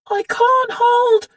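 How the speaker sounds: high, loud, slow strained cry; a girl shouting as she loses her grip; desperate shout. A narrator's reading. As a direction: shout as your grip slips. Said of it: "she shouted".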